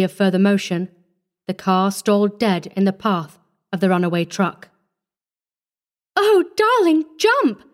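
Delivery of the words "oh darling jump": very high, urgent cry; panicked scream. A narrator's reading. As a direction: scream a desperate warning to the child. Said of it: "Missus Ellison screamed".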